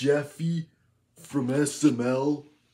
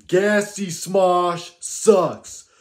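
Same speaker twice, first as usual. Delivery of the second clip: loud, slow, higher mock-furious yell; angry yelling rant; 5 semitones higher, 11 dB louder, slower than usual. Kid character roasting a rival channel, furious yell. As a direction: bellow the insult, dragging every word.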